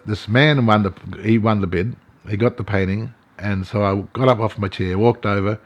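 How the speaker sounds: ordinary talk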